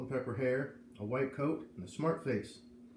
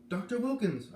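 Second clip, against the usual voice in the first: high, loud call down the hall; yelling his name; 11 semitones higher, 5 dB louder than usual. A narrator's reading. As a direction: call after the doctor. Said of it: "I yelled".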